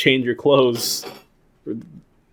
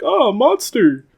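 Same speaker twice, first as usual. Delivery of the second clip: high mock shriek; mock fright; 11 semitones higher, 3 dB louder than usual. Scared onlookers spotting a monster, high mock shriek. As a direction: squeak in fake fright. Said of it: "they're like".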